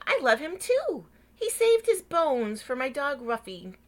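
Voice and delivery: high and loud, gushing; eager. Acted. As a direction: chime in with delight. Missus Weed praising Mister Hatch.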